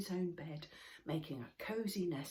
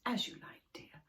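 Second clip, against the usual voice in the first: tender mother; 6 semitones higher than usual. Acted. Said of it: "said Moomin Mama".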